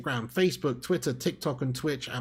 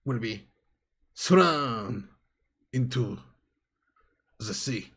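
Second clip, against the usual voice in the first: mock profound; 2 semitones lower, slower than usual. Mock profound Cantona quote.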